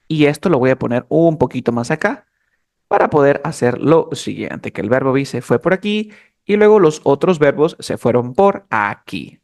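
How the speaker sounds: normal talking voice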